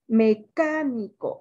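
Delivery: "Mecánico" slowly, with CA brought out; stress on CA. Shown as a demonstration. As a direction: sound out "Mecánico", leaning on "cá". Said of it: "la sílaba tónica es K". ("Mecánico" lento, marcando la sílaba CA)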